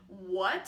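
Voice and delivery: "What" dipped low, then rising in confusion; a low, puzzled "What"; third tone. Shown as a demonstration. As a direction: dip "What" down and lift it, not understanding. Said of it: "third tone kind of implies a confusion".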